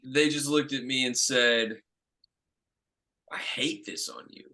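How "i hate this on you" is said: barely voiced, breathy hush; quietly retold casting note; hushed verdict. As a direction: deliver the blow in a near whisper. Casting director's blunt verdict.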